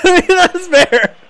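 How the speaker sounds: high laugh through the words. Real, not acted. Streamer conceding a chat joke, laughing.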